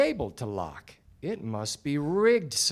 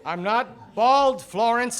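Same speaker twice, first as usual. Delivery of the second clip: indignant denial; 7 semitones higher, 4 dB louder than usual. Acted. Detective denies being bald.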